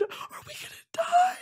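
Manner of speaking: very high squeaky fearful question; hammed-up fear; mock terror. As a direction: squeal it like you're doomed. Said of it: "and I said".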